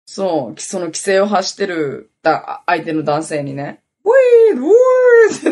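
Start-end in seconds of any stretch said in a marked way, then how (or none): 4.0-5.5 very high, loud wee through laughter; laughing wee squeal. Retold or imitated. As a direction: squeal the game sound while cracking up. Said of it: "ってね". (笑いながら甲高い「ウィー」)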